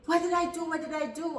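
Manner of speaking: crying out under the blows; panicked plea. Retold or imitated. Child pleading with mother during beating, panicked. Retold plea while being beaten.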